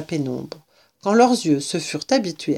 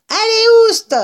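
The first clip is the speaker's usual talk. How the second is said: very high, loud rat shriek; yelling rat; 7 dB louder than usual. A narrator's reading. Rat chasing intruders away, high yell. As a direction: screech it like a furious little rat. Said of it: "hurlait le rat".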